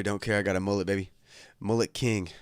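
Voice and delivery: ordinary talk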